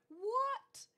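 high, loud rising yelp; startled disbelief. Real, not acted. Startled by an unfamiliar alert.

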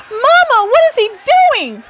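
put-on very high, loud child's cry; frightened little boy. A narrator's reading. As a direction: cry out like a scared kid. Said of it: "cried a little boy".

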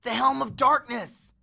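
high, loud exclamation of shock; gasped astonishment. A narrator's reading. Grover recognises the war helmet, gasping in astonishment. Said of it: "Grover gasped".

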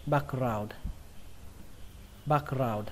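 BACKGROUND closed on a D; final D sound. Shown as a demonstration. Teacher reminding learners of the ending, final D. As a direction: end "background" with an audible D. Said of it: "kết thúc bằng âm đờ nhé".

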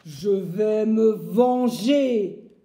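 slow, loud menacing growl; woodcutter growling. Acted. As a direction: drag out the threat of revenge. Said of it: "gronde le bûcheron". (grondement lent et menaçant du bûcheron)

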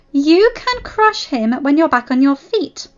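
high, loud, firm command; ordering. Acted. Claudine to injured Strat, ordering sternly. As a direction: order a raging patient to wait. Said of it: "Claudine ordered".

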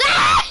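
very high squealed scream; sudden piercing squeal; playful scream. Pretend. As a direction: shriek in play, about to laugh. Screams while playing, then laughs.

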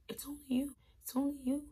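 high mocking sweet talk; mock the guy's sweet talk. Mocking guys' lines.

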